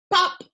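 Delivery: high loud pop; popping sound. Acted. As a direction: pop it out like a bubble. Popping noise from spots.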